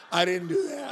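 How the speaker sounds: playfully loud mock denial; a hammed-up retold yelp of innocence; mock protest.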